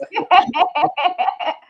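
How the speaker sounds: sudden high burst of laughter; a quick delighted laugh. Real, not acted. Laughing about not moving back.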